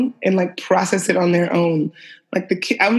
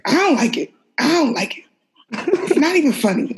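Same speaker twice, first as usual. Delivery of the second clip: dad's complaint climbing higher each time, breaking into a laugh; mocking dad; 7 semitones higher than usual. Dad grumbling at the TV show, voiced higher and higher with a laugh. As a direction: mimic a grumpy dad who can't stand the show, laughing by the end. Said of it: "And he was just like".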